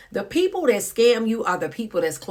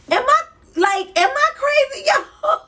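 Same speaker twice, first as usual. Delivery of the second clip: high, loud laughing cry of disbelief; 4 dB louder than usual. Real, not acted. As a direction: laugh out the question, can't believe it. Incredulous laughing outburst.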